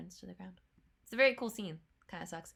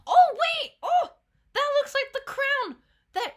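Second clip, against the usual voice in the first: much higher and louder sudden cry; excited outburst; 12 dB louder than usual. Real, not acted. Viewer spots the Bowsette crown, excited shout. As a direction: burst out as you spot something.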